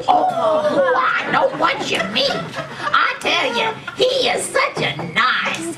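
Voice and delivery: gushing. Acted. Old lady gushing about Rodney, high excited squeal.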